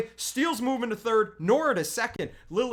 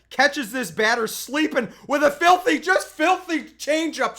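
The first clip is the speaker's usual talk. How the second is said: excited; 4 semitones higher, 6 dB louder than usual. Real, not acted. Marvelling at a changeup.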